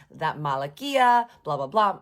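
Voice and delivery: loud, drawn-out mock scolding; mock an angry telling-off; imitated reprimand. Man reprimands friend over a cheating asshole, imitated scolding. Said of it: "Dude is reprimanding Dino".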